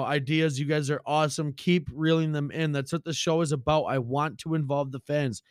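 ordinary delivery

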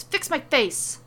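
loud high yell stressing my. Acted. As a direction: bark the order at her. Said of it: "turns to Liv and yells".

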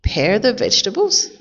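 usual speaking voice

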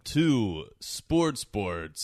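loud, slow host welcome; announcer voice. Acted. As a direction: open the show like an announcer. Hosting show intro.

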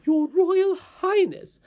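very high, loud, slow pronouncement; grandly insistent. Acted. Nurse telling princess her proper title, grandly insistent. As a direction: draw out the title emphatically.

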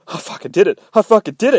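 high, loud, amazed exclamation; breathless disbelief. Retold or imitated. Sonya with axe in her victim, amazed exclamation. Sonya after killing, excited retelling.

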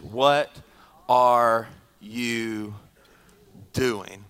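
"What" "are you" "doing?" each set apart, slow and soft; announcing the title "What are you doing?" word by word, slowly; title spelled out slowly. Shown as a demonstration. Pastor giving the sermon title, slow with pauses. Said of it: "My title today is".

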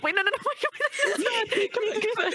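high, laughing protest; laughing panic. Real, not acted. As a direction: laugh while pleading wait. Laughing panic over friendship.

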